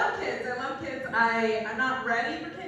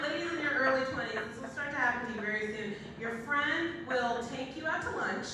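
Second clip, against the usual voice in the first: ordinary talk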